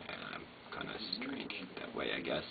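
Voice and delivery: soft whispered aside; whispering. Real, not acted. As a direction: whisper it to yourself. Whispered self-deprecating aside.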